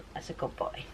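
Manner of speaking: hushed whispered praise. Real, not acted. Whispering to dog.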